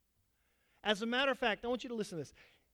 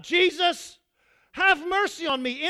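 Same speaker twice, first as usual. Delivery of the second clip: loud, higher-pitched acted cry for mercy; imitated yell; 6 semitones higher, 7 dB louder than usual. Man on the road yelling out to Jesus, imitated shout. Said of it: "this man begins to yell out".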